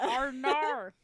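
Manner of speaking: high-pitched fake-Aussie "oh no"; mock Australian accent. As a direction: lean on the silly Australian R. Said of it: "add an R to the end of your words".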